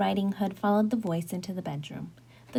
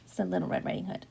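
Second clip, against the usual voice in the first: plain reading voice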